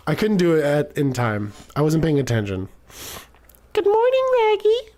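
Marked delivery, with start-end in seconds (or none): 3.7-4.9 put-on much higher, girlish and sweet morning greeting; a cheerful young girl greeting her friend, pitched way up; Zelda voice. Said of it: "is this the same voice I gave Zelda".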